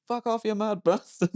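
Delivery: panicked Alan. Retold or imitated. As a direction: yelp it while fleeing. Alan driving away from stalker.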